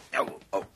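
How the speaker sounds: breathy cries of pain; pained yelps. Acted. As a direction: gasp out the hurt. Being hit.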